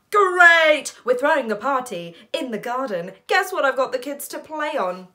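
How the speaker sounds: loud, high, eager phone voice; excited brother. Acted. Brother Flynn describing the garden party, loudly excited. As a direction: burst out, then tease the big surprise.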